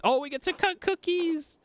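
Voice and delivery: high-pitched squeal of delight; excited squeal. Real, not acted. Delighted at cookie cutting.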